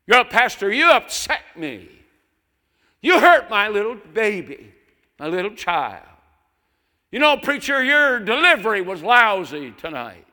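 shrill, high, loud whiny parishioner; mocking complainer. Preacher mimics a parishioner scolding the pastor, high and shrill. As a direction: lampoon an indignant churchgoer. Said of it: "disrespectfully speak to their pastor".

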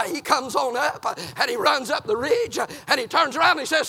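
normal talking voice

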